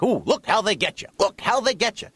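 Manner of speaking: suspicious dad. Retold or imitated. Dad quoting his pet expression, suspicious. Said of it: "my dad has expressions like".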